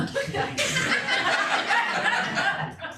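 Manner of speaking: long, high wheezing laugh; breathy giggle at his own euphemism; real, helpless high-pitched laughing; amused laughter. Real, not acted.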